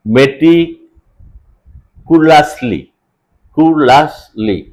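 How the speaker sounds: "মেটিকুলাসলি" in slow separate chunks; syllable split. Shown as a demonstration. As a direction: break off and repeat "কুলাসলি" as ku-las-li with long gaps. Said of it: "ইংরেজি সিলেবলের মতো".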